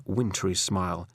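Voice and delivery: usual speaking voice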